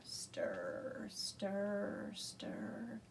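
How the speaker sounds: soft whispered chant; quiet stirring chant; whispered stir. Shown as a demonstration. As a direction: hush it while stirring. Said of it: "can you stir".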